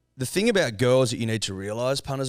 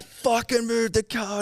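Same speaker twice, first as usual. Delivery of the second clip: high-pitched, drawn-out mock yell; imitated yelling; 12 semitones higher, slower than usual. Girl out the window at man blocking them, mock yell. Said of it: "everyone's barking and yelling and shit".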